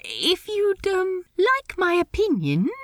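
high, halting, polite offer; hesitant. A narrator's reading. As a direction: begin tentatively, up high. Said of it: "Steed began hesitantly".

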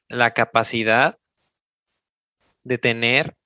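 each phrase slow, with long pauses between; slow dictation. Shown as a demonstration. Coach dictating the question to listeners, slow and spaced. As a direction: dictate the question slowly for note-taking. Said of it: "O sea, es decir".

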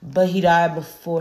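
ordinary delivery

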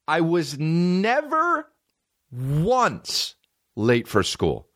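very slow, "never" and "once" pitched high; emphatic insistence. Real, not acted. Rebutting mom about being late, slow high "never" and "once". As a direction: stretch "never" and "once" indignantly.

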